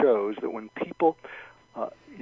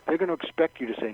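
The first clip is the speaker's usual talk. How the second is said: normal talking voice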